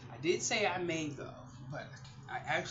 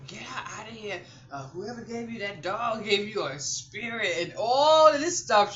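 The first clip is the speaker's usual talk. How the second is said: higher, louder mock-outraged demand; imitated angry daughter; 6 semitones higher, 11 dB louder than usual. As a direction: play the daughter wanting the doll gone. Daughter demanding doll be removed.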